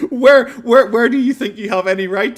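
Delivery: high and loud, laughing through it; real laughter cracking the words; laughing rant. Real, not acted. Laughing while ranting.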